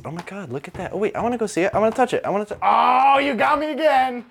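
high, rising eager bull; excited bull voice. Acted. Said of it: "That's Bull's actual reactions".

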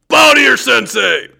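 high barked dojo command, shouted; sharp martial arts commands. Retold or imitated. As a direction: yell it like a martial arts instructor. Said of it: "But I can make up for some of that right now".

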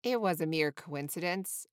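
ordinary delivery

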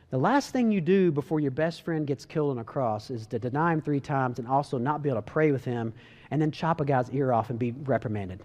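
ordinary talk, nothing marked

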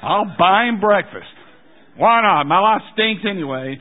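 loud, high, exasperated giving in; grudging surrender. Acted. Husband grudgingly giving in to his wife, exasperated and loud. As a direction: give in loudly, with mock self-pity.

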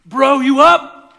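loud, high excited bro shouting; frat bro. Acted. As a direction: yell like a hyped frat bro at midnight. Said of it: "this is how the story goes".

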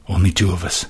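soft, hollow echo of his words; stunned murmur. Acted. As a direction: repeat it to herself, numb. Viola's eyes grew heavy.

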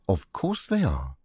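ordinary delivery

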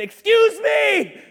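high, loud, drawn-out mock shout; brash kid challenge. Boy confronting a grown man, brash mock shout. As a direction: call it out like an indignant little boy. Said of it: "went up to a thirty-five-year-old man and went".

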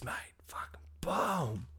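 soft breathy whisper, slow on the boom. Real, not acted. Podcaster to listeners, hushed whisper on the punchline. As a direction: drop to a secret whisper.

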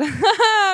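short high laugh; burst of laughter. Real, not acted. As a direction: laugh out loud. Laughs after joke.

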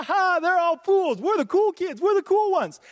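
high, loud, gloating taunt with a laugh; mocking cool kids. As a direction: crow it like an exclusive little clique. Mimicking gloating gossips in sermon.

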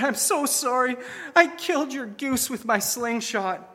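lightly voiced boy owning up, close to crying; in tears. Boy confessing to grandma in tears, lightly tearful. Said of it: "and in tears".

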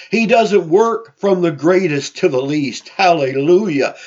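ordinary delivery

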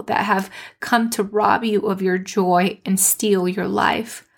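normal talking voice

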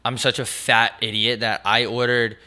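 ordinary talk

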